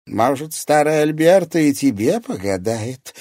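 a hint of a sly old fortune-teller. A narrator's reading.

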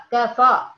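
كفا said loud with the R dropped, clipped short; wrong clipped R. Shown as a demonstration. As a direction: bark كفا, swallowing the R. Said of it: "أنتبه ما أقولش كفا". (كفا بدون الراء غلط)

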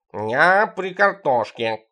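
calm and slow, unbothered; drawn out, perfectly unruffled; the cat answers unbothered, slowly. Acted. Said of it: "невозмутимо отозвался Тихон".

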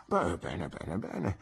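put-on soft, doting old doctor trailing off; a mild old man fussing gently; kindly old doctor. Old doctor explaining Jack Maldon's return, soft and doting.

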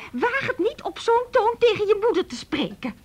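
high, loud, indignant scolding; furious mother. Retold or imitated. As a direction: put the daughter in her place. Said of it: "Ze werd woedend". (woedende moeder die terugsnauwt)

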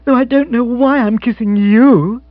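high, loud, gushing female voice; excited lifted girlish character voice; elated young woman. Acted. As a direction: bubble over with happiness. Jill overcome in Bill's arms.

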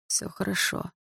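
soft, whispered reassurance; warm whisper. A narrator's reading. Лёха comforts the crying girl in a warm whisper. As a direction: soothe her gently. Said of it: "тёплым голосом повторил парень".

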